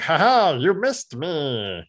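high laugh then slow teasing drawl; mock taunt. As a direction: gloat like a cocky bot. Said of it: "and he's like".

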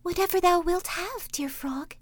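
princess voice. Acted. Princess answering the frog: high voice. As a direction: lift the voice up high for the young princess. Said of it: "said she".

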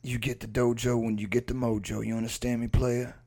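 laid-back drawled tough guy; mock-profound action-star patter; Steven Seagal impression. Co-host lecturing a "player" on mojo, drawled impression. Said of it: "the one he's got now where he's like".

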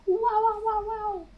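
squeaky high wow. Real, not acted. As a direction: cheer in a high voice. Cheering patrons.

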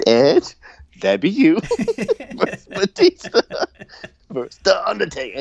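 high, laughing replay of the announcer's call; giggling imitation. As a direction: giggle your way through repeating the match card. Host replays announcer's lines giggling.

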